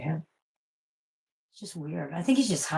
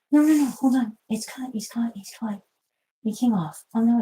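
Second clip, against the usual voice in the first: higher panicked no no no; higher worried outburst; alarmed; 6 semitones higher, 4 dB louder than usual. Real, not acted. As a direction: panic that the claw bled. Panic over cutting the claw.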